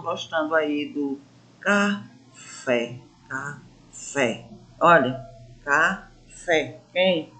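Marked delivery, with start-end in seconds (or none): 1.6-4.5 "café" split in two, weight on "fé"; "café" slowly broken up, landing on "fé"; stress on last syllable. Shown as a demonstration. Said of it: "eu pronuncio com mais intensidade". 5.6-6.8 "café" slowly split, stress on "fé"; "café" as a slow demo of final-syllable stress; stress on last syllable. Shown as a demonstration. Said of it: "eu pronuncio com mais intensidade".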